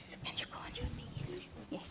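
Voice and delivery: whispered stage direction; quietly cue the helper without the crowd hearing. Real, not acted. Preacher asking a volunteer to kneel, whispering.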